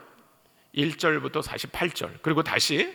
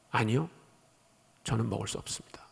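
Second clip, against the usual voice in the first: low, soft refusal as Peter; imitating a subdued, humble no; hushed refusal; 7 semitones lower, 7 dB quieter than usual. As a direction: decline quietly, voice dropping away. Voices Peter's refusal to God.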